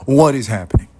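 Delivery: loud, high bewildered cry; a hammed-up what-is-going-on yelp; mock bewilderment. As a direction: throw up your hands in mock confusion.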